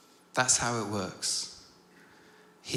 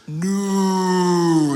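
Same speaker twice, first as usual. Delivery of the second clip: long, drawn-out high "No"; slow-motion cry; 7 semitones higher, 10 dB louder than usual. Retold or imitated. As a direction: stretch a loud, horrified wail like film slow motion. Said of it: "almost like it went into slow motion".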